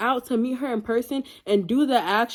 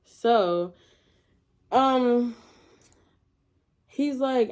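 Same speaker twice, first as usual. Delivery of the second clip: ordinary delivery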